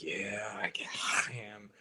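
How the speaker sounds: sheepish whisper. Retold or imitated. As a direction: whisper it, a bit embarrassed. Admitting being the Scream guy.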